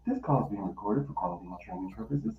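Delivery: recorded announcement. Retold or imitated. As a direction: mimic the automated message quickly. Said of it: "And I'm like, Boop".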